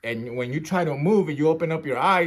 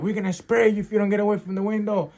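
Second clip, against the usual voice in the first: raised-pitch mock angry warning; threatening guard; 7 semitones higher than usual. Guard threatening to spray inmates, imitated angry warning. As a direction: threaten them as an irate guard. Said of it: "They start cussing at you".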